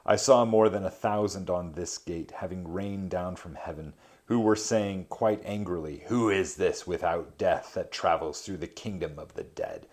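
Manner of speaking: measured reading of an epic poem. A narrator's reading. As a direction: read the verse with gravity. Reads Dante translation aloud.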